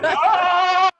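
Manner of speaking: high, loud laughing mock shriek of panic; loud laughing yelp of alarm; panicked shriek. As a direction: shriek like a startled student caught off guard. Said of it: "And they're like".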